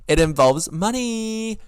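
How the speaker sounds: high, loud, drawn-out reveal; indignant reveal. Real, not acted. Revealing that the favor involves money, indignant. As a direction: stretch it out, pitched high in disbelief.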